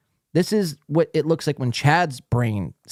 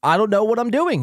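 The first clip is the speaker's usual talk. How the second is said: higher, mocking repeat of his line; mocking Chad's words; 7 semitones higher than usual. Host mocking Chad's admission, higher mocking echo. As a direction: echo his confession with amused scorn. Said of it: "he says".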